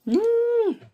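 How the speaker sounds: a high, loud moo-like groan of disgust; cow-like moo. Real, not acted. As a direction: groan like a cow without meaning to. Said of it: "I sounded like a cow just now".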